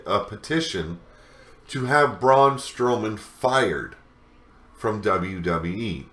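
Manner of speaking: ordinary talk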